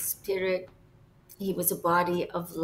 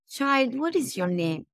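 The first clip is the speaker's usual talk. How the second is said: higher-pitched rhetorical question; 5 semitones higher than usual. Retold or imitated. Late Pope asking the child her name, rhetorical. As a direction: ask as if you already know the answer. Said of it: "he was saying it in a rhetorical way".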